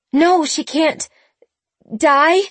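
high, loud anguished plea; crying out in despair; distraught. A narrator's reading. Sabrina distraught over dying aunt.

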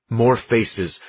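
ordinary delivery

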